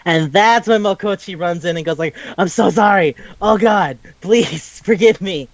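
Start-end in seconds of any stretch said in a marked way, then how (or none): 2.4-5.5 hammed-up begging with a laugh; mock pleading apology. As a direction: beg forgiveness in playful imitation, stretching the end. Mokochi runs in begging forgiveness.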